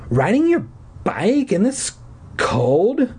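high, strained, disbelieving question; hoarse, angry. A narrator's reading. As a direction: croak it out as an incredulous challenge. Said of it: "Her voice was hoarse".

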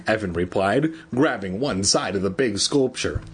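usual speaking voice

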